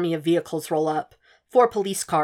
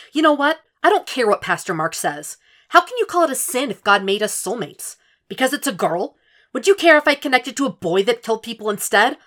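higher and louder, indignant; angry argument; 6 semitones higher, 5 dB louder than usual. A narrator's reading. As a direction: fight back against her mother.

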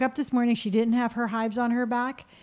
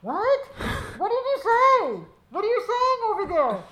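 much higher, half-laughing imitation of a nosy brother; mock nosy interrupter; 11 semitones higher than usual. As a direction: squeak it like a brother who hears everything. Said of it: "and you hear".

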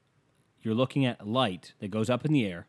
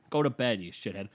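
usual speaking voice

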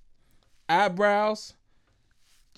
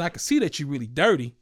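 normal talking voice

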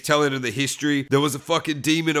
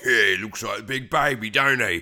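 mock gangster; 4 semitones lower than usual. Clockwork Orange gangsters jumping JB.

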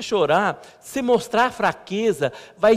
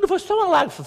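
much higher, teasing protest; playful protest; 12 semitones higher, faster than usual. Retold or imitated. Husband denying he cried to his wife, high playful protest. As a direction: play it up like a squeaky denial.

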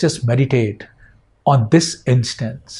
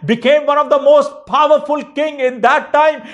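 full-force preaching; 9 semitones higher than usual. Acted. Pastor preaching to the church about God giving all, high and impassioned. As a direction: preach it out with rising fervor.

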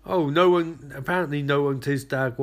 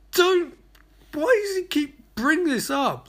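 exasperated; 14 semitones higher, 3 dB louder than usual. Real, not acted. Exasperated at repetitive character.

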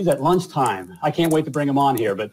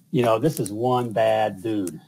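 ordinary delivery

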